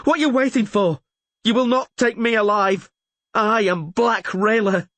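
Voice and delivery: high, defiant battle cry; savage scream. A narrator's reading. Rayla defies the surrounding elves, screaming savagely. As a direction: scream defiance at the encircling enemy. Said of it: "she screamed savagely".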